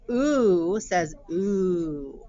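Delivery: "oo says oo" stretched slowly; slow, drawn-out "oo"; drawn-out vowel. Shown as a demonstration. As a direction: hold the "oo" sound long.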